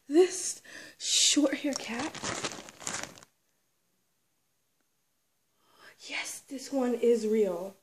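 slow, hushed whisper of wonder; awed whisper. Real, not acted. Collector whispering to viewers about a real toy. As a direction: breathe it reverently. Showing a treasured figure.

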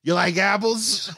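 put-on high-pitched Boston accent. Teasing the laughing co-host, high Boston accent. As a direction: taunt them like a Bostonian. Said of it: "so good at a Boston accent".

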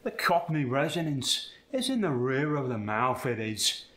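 light Cockney accent from the back of the mouth; a touch of Cockney, resonance in the rear of the mouth. Shown as a demonstration. Coach shows the Cockney placement. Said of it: "with a Cockney accent".

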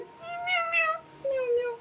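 very high, slow drawn-out meow; cat meow. Pretend. Mother mews like a cat at her parrot, very high and drawn out. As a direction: mew like a little cat.

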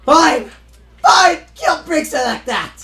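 loud, high, snapped yell; angry shout. Real, not acted. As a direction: throw it out in anger. Angry outburst at the game.

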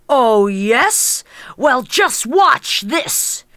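slow, boastful ogre voice; a lightly voiced ogre daring him; ogre retort. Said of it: "retorted the ogre".

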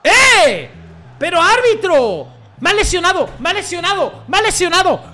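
gritos agudos y fuertes al árbitro; protesta furiosa. Real, not acted. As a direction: reclama la falta a voz en grito. (grita al árbitro que le han lesionado)

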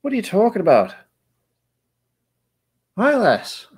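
high-pitched brogue; put-on Celtic accent. Streamer still failing to copy the accent, high hammy brogue. Trying out Irish or Scottish brogue.

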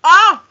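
loud sudden yell of pain. Real, not acted. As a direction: cry out as the heat hits. First hot bite burns.

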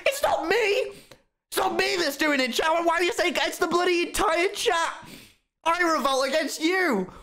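very high squeaky voice, mock outrage; squeaky mock protest. Streamer protests against chat.

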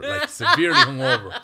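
laughing through the words, pitch jumping up; can't keep a straight face while telling it. Real, not acted. Telling the podcast about the hungover ring trip, laughing.

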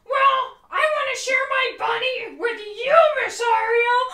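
high, loud and eager; eager puppet voice. Acted. Puppet offers bunny to teacher, high eager voice. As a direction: pipe up loudly with an offer.

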